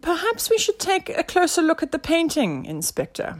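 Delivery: high-pitched tentative suggestion; sidekick's helpful hint; suggesting sidekick. A narrator's reading. Nelson gently suggests.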